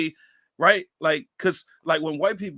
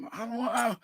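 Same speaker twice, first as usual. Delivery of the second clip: put-on higher-pitched reluctant kid; mock complaining kid; 5 semitones higher than usual. Dad imitating kids told to play outside, complaining. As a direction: lightly imitate a bored child.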